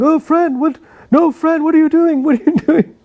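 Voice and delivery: high, pleading panic with a laugh breaking in at the end; panicked uncle. Retold or imitated. Great-uncle pleading as a friend is pretend-shot, high panicked. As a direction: squeal it like a frightened tall skinny man. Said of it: "And he's going".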